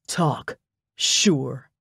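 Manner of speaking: low, dismissive "sure"; scornful snort. A narrator's reading. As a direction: toss it off with contempt. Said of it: "He snorted".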